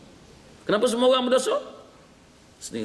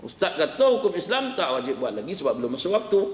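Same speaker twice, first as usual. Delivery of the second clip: ordinary talk, nothing marked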